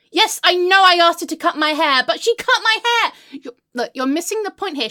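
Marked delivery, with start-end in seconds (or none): 0.1-3.1 loud, indignant protest rising in pitch; exasperated shout. Acted. Arguing with friend over the haircut, exasperated shout. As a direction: shout down the objection. Defends outrage to doubting friend.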